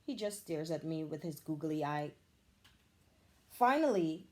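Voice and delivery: plain reading voice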